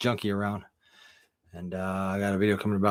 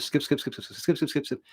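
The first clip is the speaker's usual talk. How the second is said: high, rapid-fire repeated word; mimed fast-forward; 5 semitones higher than usual. Pretend. Storyteller jumps past details to leave out, mimed fast-forward. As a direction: chatter it like a tape skipping ahead.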